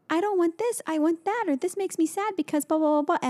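imitating a little girl's high whiny complaint; toddler voice. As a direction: pitch way up to play the daughter. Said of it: "sometimes she'll go".

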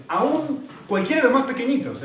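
"aún" pushed high and held out; emphatic stress. Shown as a demonstration. Preacher stressing even the smallest commandment, emphatic "aún". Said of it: "es más enfático".

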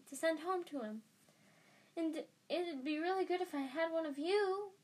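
high-pitched, gushing schoolboy; excited Colin. Acted. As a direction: babble with breathless eagerness in a high voice. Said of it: "breath of excitement and said".

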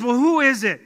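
a hint of a dangling man's urgency; eager question. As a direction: call up with sudden hope. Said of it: "And he says".

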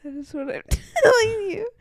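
high, loud, tearful laughing; laughing insistence. Real, not acted. Host confirming to co-host the door opened, tearful laughter. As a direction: confirm it while cracking up.

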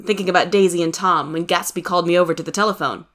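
ordinary delivery, nothing marked

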